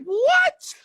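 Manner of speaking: very high, loud incredulous yelp; shocked what. Retold or imitated. Reacting to the crowd cheering a car thief, high shocked yelp. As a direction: disbelief jumping out of you. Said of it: "I'm like".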